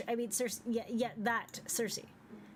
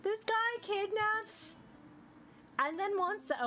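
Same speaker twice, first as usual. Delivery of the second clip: much higher and slow, indignant whine; whiny character voice; 10 semitones higher, slower than usual. Acted. Companion protests the kidnapper joining them, high whine. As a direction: complain in a drawn-out high voice.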